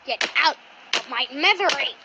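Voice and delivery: usual speaking voice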